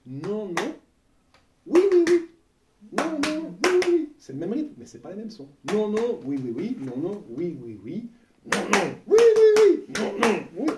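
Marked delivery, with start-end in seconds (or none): 0.1-4.1 "Oui, oui, oui" squealed very high and loud; emotional no yes. Shown as a demonstration. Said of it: "On joue un petit peu avec l'émotionnel". 5.7-10.7 chanted, louder and higher on oui; emotional non non oui oui oui. Shown as a demonstration. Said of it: "On joue un petit peu avec l'émotionnel".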